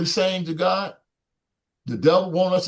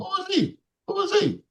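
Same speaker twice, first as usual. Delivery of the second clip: much higher, incredulous scoff; scoffing Pharisee. Retold or imitated. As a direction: sneer the question up high. Pharisees questioning Jesus, acted.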